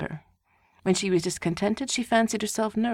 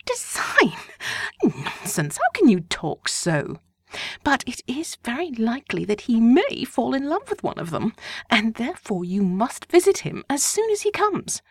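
higher voice brushing him off; dismissive wife; 5 semitones higher, 3 dB louder than usual. A narrator's reading. Wife dismissing husband's teasing, lightly higher. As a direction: wave away his question.

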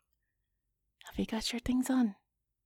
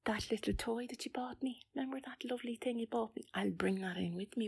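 whispering; 8 dB quieter than usual. Acted. Whispering secret plans on phone.

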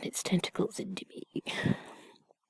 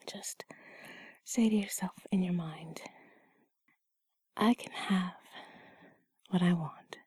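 ASMR whisper; 6 dB quieter than usual. Acted. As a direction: gently coax them to relax.

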